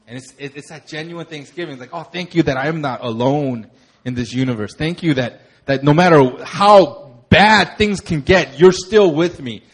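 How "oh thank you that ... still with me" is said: fervent prayer. Acted. Pastor voicing his prayer to God, loud and fervent. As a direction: pour it out to God, building louder. Praying aloud in gratitude.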